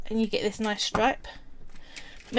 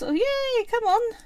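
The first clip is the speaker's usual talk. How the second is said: sarcastic yay; 12 semitones higher than usual. Real, not acted. Mocking her own immune system.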